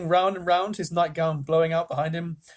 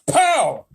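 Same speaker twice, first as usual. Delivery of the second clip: loud pow; 12 semitones higher, 10 dB louder than usual. Acted. Crash sound effect.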